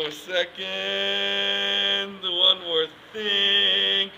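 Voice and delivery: very slow, stretched words on a level pitch; drawn-out stalling. Pretend. Comic stalling the listener before his next bit, drawn-out. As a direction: hold each word out in a monotone.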